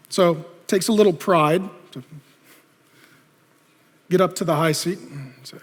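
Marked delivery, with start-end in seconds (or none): none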